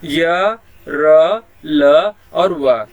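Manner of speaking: य, र, ल, व said loud and very slow; semivowels spelt out. Shown as a demonstration. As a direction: stretch out each semivowel. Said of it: "जैसे हो गया". (य, र, ल, व ज़ोर से और बहुत धीरे)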